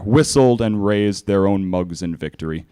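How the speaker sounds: ordinary delivery, nothing marked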